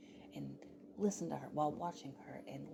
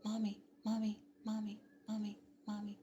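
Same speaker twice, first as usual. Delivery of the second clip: put-on high, evenly repeated child's call; pitched-up impression of an insistent kid; imitated nagging child; 6 semitones higher than usual. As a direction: keep calling for mother's attention. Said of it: "A J just kept saying".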